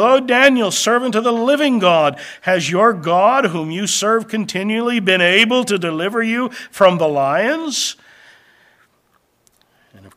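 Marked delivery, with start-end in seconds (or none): 0.0-8.0 calling out. Acted. King calls to Daniel in den.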